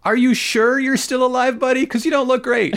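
high, sarcastic taunt at a melting villain; mock taunting voice. Co-host taunting the melting Kars, mock high voice. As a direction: tease him like a smug onlooker.

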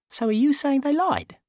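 high put-on voice, puzzled; baffled Dickie. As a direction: ask it dim and confused.

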